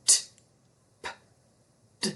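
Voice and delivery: "T, p, d" short clipped stops; stop sounds. Shown as a demonstration. Teacher demonstrating stop sounds, short and cut off. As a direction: cut each sound off sharply. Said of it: "Some sounds do".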